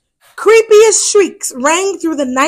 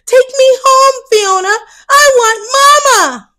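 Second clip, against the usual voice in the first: much higher, louder, panicky wail; frightened plea; 10 semitones higher, 5 dB louder than usual. Acted. To sister Fiona, tangled in a web, panicked wail. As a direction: beg to go home, scared.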